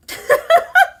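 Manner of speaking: high, loud burst of giggles; excited laugh. Real, not acted. Astrologer who can't stay calm, high excited laughter.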